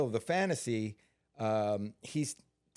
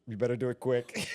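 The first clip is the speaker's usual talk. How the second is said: laughing through it. Retold or imitated. Retelling a hurt stunt retake.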